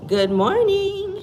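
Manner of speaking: squeaky high good morning; high sing-song greeting. Real, not acted. Greeting viewers in the gym.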